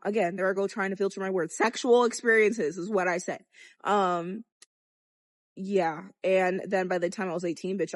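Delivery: ordinary talk, nothing marked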